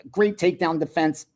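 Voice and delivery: ordinary delivery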